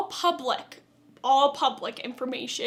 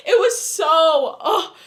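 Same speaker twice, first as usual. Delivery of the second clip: louder with an exasperated laugh; real annoyance breaking into a laugh; pissed off; 3 semitones higher, 7 dB louder than usual. Real, not acted. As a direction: vent the irritation with a laughing ugh. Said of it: "that pissed me off".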